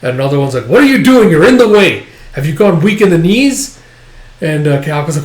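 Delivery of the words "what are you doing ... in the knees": much higher and louder, scolding yell; angry soldier shout. Acted. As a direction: bark at him to move. Soldier yells at Kafka.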